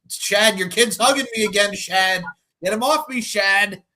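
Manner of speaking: calling out. Real, not acted. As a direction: call Shad for help, half laughing. Calling for help, kid hugging.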